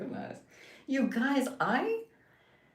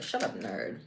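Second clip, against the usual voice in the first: mock bully; 7 semitones lower, 5 dB quieter than usual. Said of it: "It's like".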